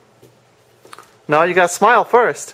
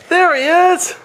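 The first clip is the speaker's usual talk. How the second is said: high, excited peekaboo reveal; playful surprise; 11 semitones higher, slower than usual. Acted. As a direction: pop it out like finding him. Finding hiding child.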